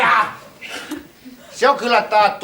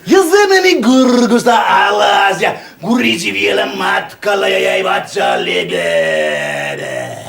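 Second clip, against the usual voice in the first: gurgling shout; 5 dB louder, slower than usual. Acted. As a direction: shout it as the wine rolls down. Wine gurgling down the throat.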